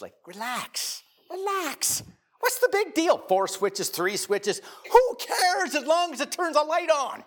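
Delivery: high, dismissive, sing-song scoff; mocking inner voice. Preacher voicing his dismissive thoughts at his brother, high mock scoff. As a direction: brush it off like it's nothing. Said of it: "This is what I'm thinking in my brain".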